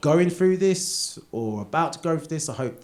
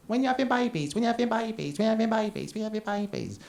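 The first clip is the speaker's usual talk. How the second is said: higher, repetitive nosy voice; mock nagging; 10 semitones higher than usual. Nosy relatives pestering childless couples, high mock nagging. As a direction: nag like a pushy relative. Mocking nosy repeated question.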